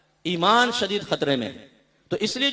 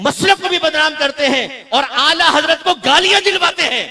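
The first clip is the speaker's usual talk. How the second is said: loud admonishing sermon, swelling to a high peak; impassioned preaching; 6 semitones higher, 10 dB louder than usual. Real, not acted. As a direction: build the rebuke louder and higher. Preaches at full force. (जोशीली, बुलंद आवाज़ में तक़रीर)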